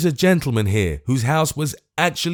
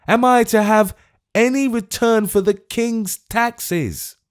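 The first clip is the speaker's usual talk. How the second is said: desperate outrage; 12 semitones higher, 5 dB louder than usual. Acted. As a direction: hammer each question home. Old man's desperate questions.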